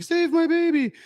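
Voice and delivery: high, loud imitation of a desperate mother; hammed-up shriek for help, far above own pitch; mock panicked cry. Lady in burning building calling down, mock panicked. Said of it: "the lady's up top and she's like".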